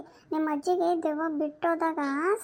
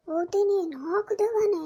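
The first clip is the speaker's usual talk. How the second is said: high-pitched astonished question; pitched-up, incredulous gasp of a question; shocked disbelief; 3 semitones higher than usual. Acted. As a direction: echo it back in amazement. Neighbour hears of four ghosts.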